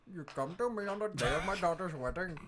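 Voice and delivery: put-on mob boss with Indian accent; Indian Don. Godfather receiving a favor seeker, in an Indian accent. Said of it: "like, an Indian Don".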